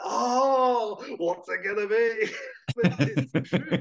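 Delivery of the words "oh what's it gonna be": high, eager imitated wonder; mock excited wondering. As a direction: play it up like a thrilled viewer. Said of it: "You're like".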